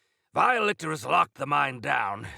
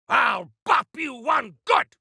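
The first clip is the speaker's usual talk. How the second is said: combat threat; 8 semitones higher, 5 dB louder than usual. Acted.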